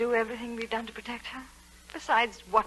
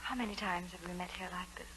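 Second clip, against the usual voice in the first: whispering; 4 semitones lower, 11 dB quieter than usual. Acted. Secret meeting, whispered.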